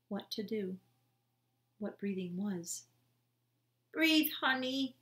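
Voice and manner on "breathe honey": tender pleading. A narrator's reading. Bryce urging dying sister.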